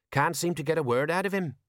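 plain reading voice

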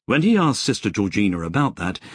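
ordinary delivery

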